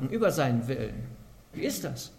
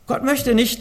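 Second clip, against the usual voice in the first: emphatic preaching; 8 semitones higher, 7 dB louder than usual. Real, not acted.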